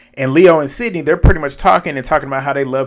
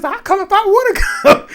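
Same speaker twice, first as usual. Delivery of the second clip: high, giggling defiance; half-laughed retort; laughing while quoting; laughing through it. Retold or imitated. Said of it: "And C T says".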